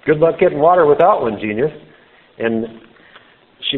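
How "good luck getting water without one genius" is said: sarcastic. Retold or imitated. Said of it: "it's kinda like she's saying".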